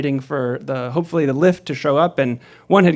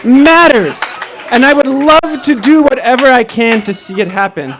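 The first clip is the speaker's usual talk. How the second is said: impassioned declaration; 9 semitones higher, 8 dB louder than usual. Retold or imitated. Storytelling teacher urging the driver that his voice matters, impassioned. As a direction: belt it out over the crowd, stretching the key words.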